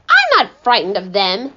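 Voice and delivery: usual speaking voice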